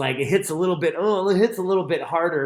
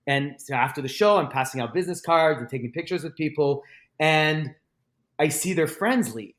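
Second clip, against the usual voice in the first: ordinary delivery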